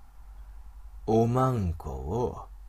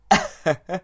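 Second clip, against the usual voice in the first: mocking chuckle; 5 semitones higher, 4 dB louder than usual. Wolf man amused.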